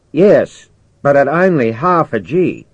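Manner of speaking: high, loud radio reply; insistent commander. Acted. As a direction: project a firm answer over the radio. Commander answers over microphone.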